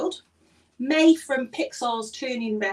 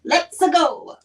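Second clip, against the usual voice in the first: Italian-accented let's go; 4 semitones higher, 7 dB louder than usual. Real, not acted. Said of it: "let's go," the English translation, you did".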